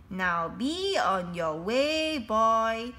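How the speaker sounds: put-on proud headmaster sending a boy off; lightly voiced pompous headmaster; headmasterish dismissal. A narrator's reading. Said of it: "done something headmasterishly".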